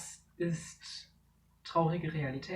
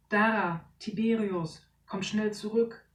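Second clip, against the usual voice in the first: higher, louder urgent call; 5 semitones higher, 5 dB louder than usual. Acted. Father calling his children away from the ship, urgent. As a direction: call them back from danger.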